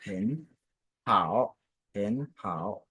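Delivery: HEN rises, HAO stays low and dipping; low, careful HEN then HAO; second tone on HEN, third on HAO; tone-change demo. Shown as a demonstration. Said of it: "devient automatiquement le deuxième ton".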